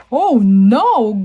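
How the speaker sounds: loud, swooping storybook oh no; dismayed cry. Acted. Grandma Shark sees her spell go wrong, dismayed cry. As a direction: exclaim it in exaggerated alarm.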